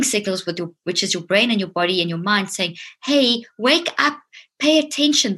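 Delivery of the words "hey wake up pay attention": higher, slow and insistent; a slowed, raised warning cry; urgent wake-up call. Pretend. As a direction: call out like an alarm going off. Mind voiced as warning alarm.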